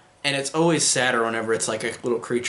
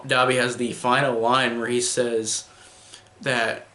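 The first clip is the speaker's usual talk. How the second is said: ordinary talk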